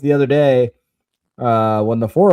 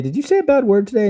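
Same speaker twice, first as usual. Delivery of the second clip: light, higher-pitched, quick question; parent questioning child; 11 semitones higher, faster than usual. Retold or imitated. Dad asks his daughter about a bad word, high-pitched coaxing question. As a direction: ask a small child in a raised pitch.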